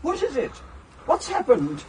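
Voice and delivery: high, anxious questions; alarmed questioning. Acted. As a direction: ask in rising alarm. Alarmed by companion's reaction to body.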